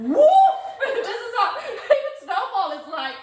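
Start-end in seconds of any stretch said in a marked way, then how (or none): 0.1-0.6 very high, loud woof; replayed woof. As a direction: bark it out, surprised at yourself. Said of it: "why am I sitting here going".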